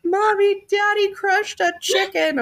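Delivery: put-on high, slow little-kid wail to his mom; crying child. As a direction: son telling his mother what dad did, in a high upset child's voice. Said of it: "He comes running inside crying".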